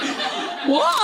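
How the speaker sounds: sudden high squeak of shock; shocked what. Retold or imitated. To a stranger inviting her canvassing, a high shocked squeak. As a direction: react like you just got ambushed.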